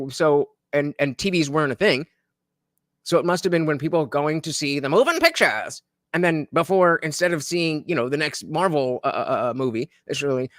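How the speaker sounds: ordinary talk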